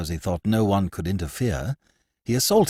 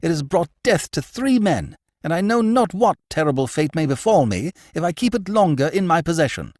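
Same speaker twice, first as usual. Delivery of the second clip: raised, urgent pleading; vehement cry; 10 semitones higher, 5 dB louder than usual. Acted. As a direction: thrust the words out, pressing. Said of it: "he cried vehemently".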